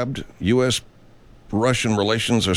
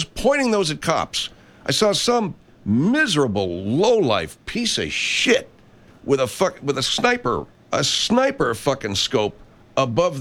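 furious rant; 6 semitones higher than usual. Real, not acted. Host rails at gunmen who aimed at cops, furious. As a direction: let the outrage boil over. Angry rant about pointing guns.

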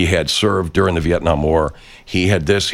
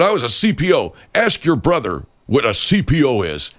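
higher, puffed-up mock voice; mock boastful troll; 8 semitones higher than usual. Quoting the troll's naval boast: higher puffed-up mock voice. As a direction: parody his self-important boast.

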